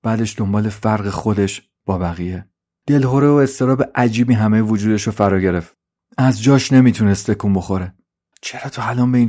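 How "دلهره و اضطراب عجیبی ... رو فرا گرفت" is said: plain reading voice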